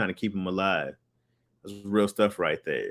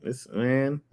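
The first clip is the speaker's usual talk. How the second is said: ordinary delivery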